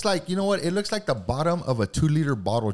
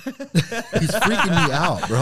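laughing; 4 semitones higher, 4 dB louder, much slower than usual. Real, not acted. Laughing while teasing friend.

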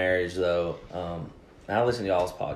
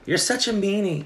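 teasing wife; 12 semitones higher than usual. Retold or imitated. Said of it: "she called me a meanie".

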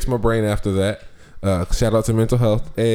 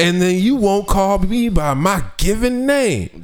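slow hammed-up indignation; mock offended; 7 semitones higher than usual. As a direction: drag out the complaint. Mock offended rant about name.

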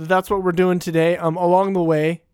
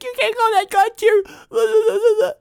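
high, slow whiny mom voice; imitating mom; much slower than usual. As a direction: squeak it out like a nagging mom. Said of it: "my mom was all like".

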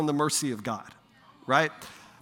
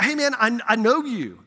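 lightly mocked nervous greeting, higher pitch; imitating nervous guy; 7 semitones higher, 6 dB louder than usual. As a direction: act out an awkward young man approaching, pitched up and louder. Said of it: "you can tell he's so nervous".

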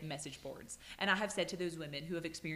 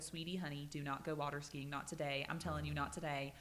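soft pleading; 5 semitones lower, 7 dB quieter than usual. Retold or imitated. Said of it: "I said".

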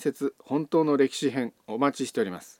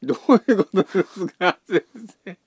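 laughing; 7 semitones higher, 6 dB louder than usual. Real, not acted. Teasing the host's praise.

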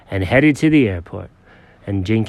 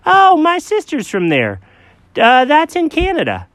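lightly voiced friend; 3 dB louder than usual. Chuck voiced high.

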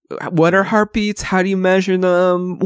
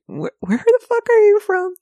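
much higher, mock angry brogue; a hammed-up furious Scot; angry Scottish brogue. Mocking the actor losing his accent in a much higher angry Scottish brogue. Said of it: "when he gets real angry, it's like".

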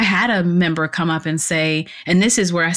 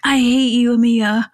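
higher mock-resentful client voice; imitated client; 6 semitones higher than usual. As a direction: play the worn-out client griping at the trainer. Imitating her exhausted, tearful clients.

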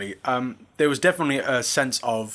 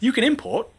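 teasing; 12 semitones higher, 3 dB louder than usual. Real, not acted. Teasing correction.

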